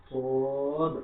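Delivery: সোয়াদ thick, long and out loud; heavy, sounded sad. Shown as a demonstration. Teacher has learners repeat aloud, heavy long সোয়াদ. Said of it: "একটু sound করে".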